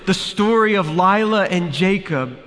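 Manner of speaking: high, loud desperate cry; urgent frightened pleading; terrified plea. A narrator's reading. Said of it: "a note of terror in her voice".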